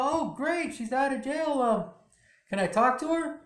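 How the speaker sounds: delighted daughter. Retold or imitated. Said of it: "She's like".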